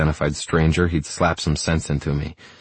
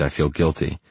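ordinary delivery, nothing marked

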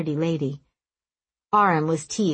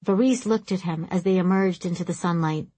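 plain reading voice

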